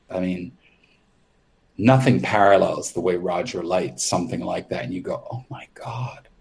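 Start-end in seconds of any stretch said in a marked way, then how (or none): 5.3-6.3 stunned by the set, barely voiced; awed whisper. A makeup artist first seeing the lit set, awed whisper. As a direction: breathe it out in a hushed whisper.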